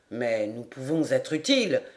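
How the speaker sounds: low-pitched character voice; protesting lord. A narrator's reading. Lord offers help to the captain, protesting in low voice.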